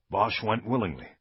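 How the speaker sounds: ordinary delivery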